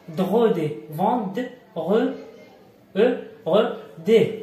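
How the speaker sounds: "فون، د، غ، دي" sounded out in slow, separated pieces; syllable by syllable. Shown as a demonstration. Teacher breaking down vendredi for learners, syllable by syllable. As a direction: break "فوندغودي" into its sounds with pauses between.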